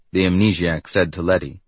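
ordinary delivery

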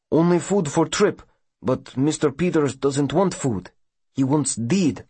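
earnest immigrant passenger pleading a family's case; foreign colonist with broken English. A narrator's reading. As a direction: explain haltingly in accented, broken English.

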